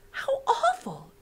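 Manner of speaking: put-on high, slow, crying child voice; girl upset for the flowers; dismayed girl.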